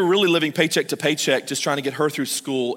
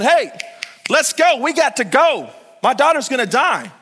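high, louder urgent pleading; a father begging Jesus to hurry, urgent and pleading; 8 semitones higher, 4 dB louder than usual. Retold or imitated. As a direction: hurry Jesus along, desperate. Jairus urging Jesus to hurry.

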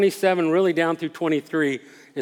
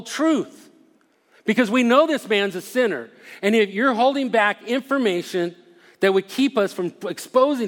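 higher-pitched, heavy stress on "We know"; emphatic insistence; 4 semitones higher than usual. Acted. Pharisees pressuring the healed man to agree, emphatic. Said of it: "saying it in such a way".

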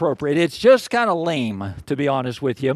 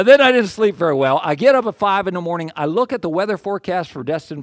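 usual speaking voice

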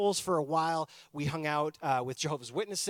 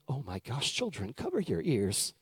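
hushed, lowered voice of a shocked teacher; softer, put-on church-lady alarm; scandalized Sunday school teacher; 6 semitones lower, 4 dB quieter than usual. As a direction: warn the class as a scandalized teacher. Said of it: "the teacher was like".

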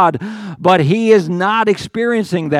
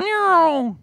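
high squeaky meow; mock mind noise; 12 semitones higher than usual. Pastor mimics a sleepless restless mind with a high meow. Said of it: "his mind's going".

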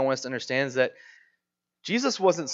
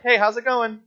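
higher, upbeat offhand hello; casual greeting; 6 semitones higher, 4 dB louder than usual. Retold or imitated. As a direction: greet them as if nothing happened. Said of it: "they're just like".

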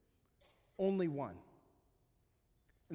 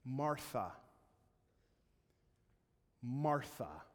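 gentle call; 3 semitones lower, slower than usual. Retold or imitated. Jesus gently calling her name.

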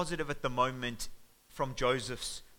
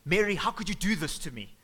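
high, loud disbelieving cry; shocked outburst; 4 semitones higher, 5 dB louder than usual. Acted. Joseph reacting in shock to Mary's news, loud and high. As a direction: blurt it out in shock. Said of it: "Joseph in shock interrupts her".

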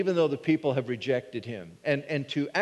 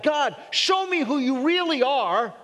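pleading Moses; 9 semitones higher, 3 dB louder than usual. Acted.

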